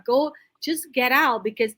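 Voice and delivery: usual speaking voice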